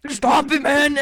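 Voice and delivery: loud mock complaint; exaggerated indignant defence; imitated protesting friend. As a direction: put on a friend's loud, exasperated voice. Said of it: "he keeps going".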